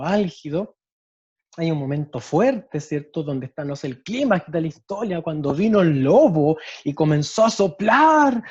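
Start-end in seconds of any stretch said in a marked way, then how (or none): 5.2-8.4 much louder and higher, dragging out the blowing; loud climax. Shown as a demonstration. Teacher showing volume at the story's climax, loud and drawn out. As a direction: boom out the wolf's huffing and puffing. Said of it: "mi volumen tiene que ser coherente".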